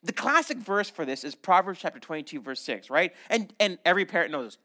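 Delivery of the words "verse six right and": usual speaking voice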